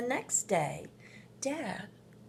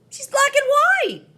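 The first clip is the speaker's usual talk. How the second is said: put-on high, loud child voice, proud; eager little boy; 18 dB louder than usual. A narrator's reading. Marvin convincing mom it's Rosie, high and eager. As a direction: announce it like proof.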